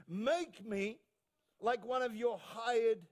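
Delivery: ringing confession, stretched out at the end; impassioned preaching. Acted. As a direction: voice the son's prepared confession with force. Preacher voices the son's rehearsed speech.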